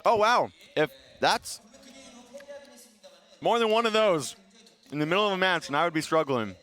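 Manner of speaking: talking through sour candy; eating sour candy. Real, not acted. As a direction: mouth puckered from sour candy. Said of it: "my face is cutting a little".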